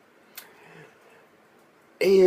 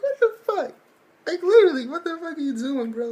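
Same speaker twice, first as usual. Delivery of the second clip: squeaky high what the fuck; his voice leaps up in disbelief; high incredulous yelp. Real, not acted. Reacting to potty joke.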